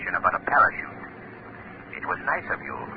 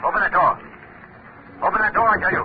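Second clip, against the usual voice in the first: louder, higher stern order; forceful command, pitched up and loud; commanding demand; 10 semitones higher, 3 dB louder, much faster than usual. Acted. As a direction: bark the order through the door. Shadow demands the door opened.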